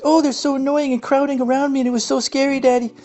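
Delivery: put-on high, whiny child voice; mock little boy. Young Justin telling Daddy his bad dream, mock whiny child voice. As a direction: pitch way up like a scared little kid.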